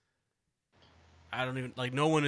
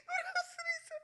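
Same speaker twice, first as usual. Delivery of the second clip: laughing repeat; 8 dB quieter, faster than usual. Real, not acted. Repeat, quieter, still laughing.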